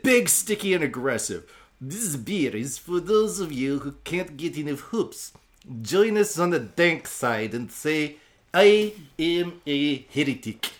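hammed-up, emphatic Russian accent; Borat-style Russian accent. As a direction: proclaim it in a fake Borat accent. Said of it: "just gonna sound like Borat".

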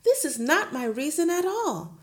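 lightly voiced gentle teacher; a hint of the meek young teacher; Miss Honey pleading. Teacher protesting to her headmistress, lightly voiced.

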